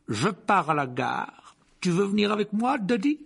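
loud call to the family; booming uncle. Acted. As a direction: announce it loudly, rising at the end. Uncle calls out to his family.

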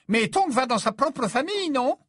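high-pitched, loud, fussing mother; insistent mother figure. Acted. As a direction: press the questions in a high voice. Narrator voices Mrs Weasley. (voix de mère aiguë et insistante)

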